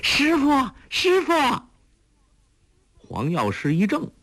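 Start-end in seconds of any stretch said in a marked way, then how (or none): 0.0-1.3 high, loud joyful shout; girl runs out calling her master loudly; loud cry. A narrator's reading. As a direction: call out to a rescuer arriving. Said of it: "大声叫道".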